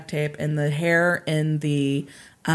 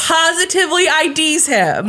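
triumphant reveal; 10 semitones higher, 5 dB louder than usual. Real, not acted. Podcaster revealing the eyewitness to cheering co-host, high triumphant delivery. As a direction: stretch it out in excited triumph.

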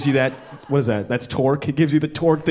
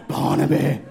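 mock gasp.

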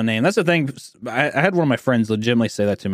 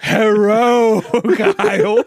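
mock Korean accent; 8 semitones higher, 4 dB louder, much slower than usual. Dad greeting his son Kyle in a mocking Korean accent. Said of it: "responding in a stereotypical accent".

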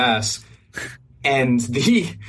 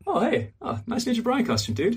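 light, higher-pitched casual greeting; casual guy, imitated; 10 semitones higher, 3 dB quieter than usual. Guy glancing back on the escalator, imitated casual compliment. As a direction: toss it off as a higher-voiced stranger. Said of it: "looks back up just casually".

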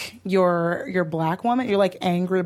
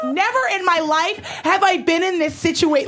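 higher, pompous outraged customer; 7 semitones higher than usual. Retold or imitated.

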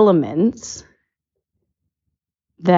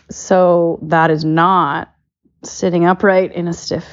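ordinary delivery

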